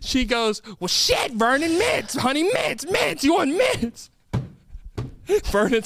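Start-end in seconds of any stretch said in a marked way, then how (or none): 0.8-4.1 exasperated grandma, pitch climbing high; fed up. Acted. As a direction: repeat it ever higher to a deaf husband. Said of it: "starting to get fed up".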